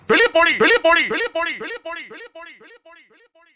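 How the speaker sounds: high echoing voice fading away into silence; the husband's order echoes in her head; echoing command. Acted. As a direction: let the repeated words ring out and die off.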